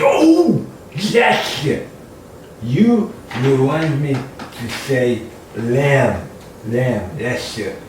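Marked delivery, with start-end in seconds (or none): 0.0-1.9 high, loud sudden realisation; hammed-up discovery, high and loud; mock eureka. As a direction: act out a sudden bright idea.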